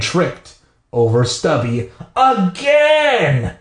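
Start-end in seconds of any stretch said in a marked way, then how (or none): 2.1-3.5 high-pitched, drawn-out exasperation; fed-up storyteller, pitched way up; exasperated. A narrator's reading. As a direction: stretch it out in comic exasperation. Narrator exasperated at tripping again.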